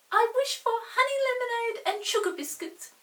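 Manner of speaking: a tiny brownie eagerly ordering treats; little brownie voice. Acted.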